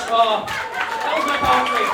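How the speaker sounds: high, loud, slow exclamation; triumphant cry after the poem; excited. Real, not acted. Poet finishing his set to the crowd, triumphant.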